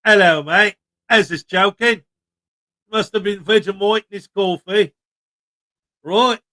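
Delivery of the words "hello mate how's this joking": lightly put-on Australian accent, cheery; hint of Aussie accent; mock Australian accent. Reading a viewer's comment in mild Australian accent. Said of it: "what accent am I supposed to read it in?".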